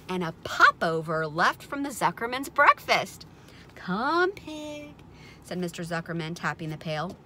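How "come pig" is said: high-pitched coaxing call; sing-song animal call; coaxing farmer. A narrator's reading. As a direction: lure the pig over in a high voice. Farmer coaxes the pig with food.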